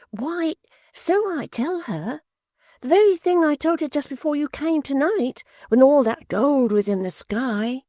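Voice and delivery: put-on high, eager simple young man. Barnaby voiced high.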